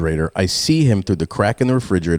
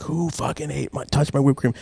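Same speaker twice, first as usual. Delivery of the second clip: retold mock scream; 7 semitones higher, 5 dB quieter than usual. Parent discovers empty whipped cream, mock screaming. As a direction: scream it at reduced volume. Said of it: "So I start screaming".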